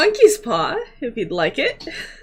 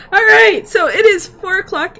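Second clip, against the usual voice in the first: loud and bright; 10 semitones higher, 7 dB louder than usual. Real, not acted. GM wraps up after laughing.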